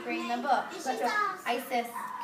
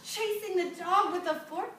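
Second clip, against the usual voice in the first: mock shocked gasp; 6 semitones higher than usual. Teacher reacts to child's answer.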